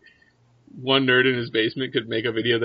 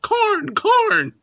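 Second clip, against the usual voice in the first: very high, loud crow caw; cawing raven; 6 dB louder than usual. Acted. Crow looks right at Russ, loud high cawing. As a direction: shriek the word like a crow. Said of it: "looks right at Russ and says".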